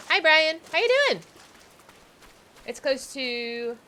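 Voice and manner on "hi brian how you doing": cheery greeting. Real, not acted. Greeting arriving viewer loudly.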